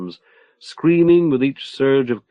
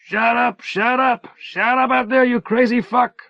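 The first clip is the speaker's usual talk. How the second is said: shouting back; 11 semitones higher than usual. A narrator's reading. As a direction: yell it back from behind the bars. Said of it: "shouting back from the other side".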